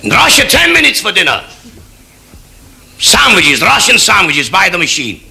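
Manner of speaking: high, forceful, emphatic declarations; demanding Soviet leader. Acted. Kosygin boasts of Russian dinners, forceful and high. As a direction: hammer out each boast, then demand an answer.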